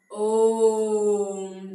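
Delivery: ओम held long and loud, the vowel stretched; drawn-out om. Shown as a demonstration. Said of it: "ज़्यादा time लग रहा है".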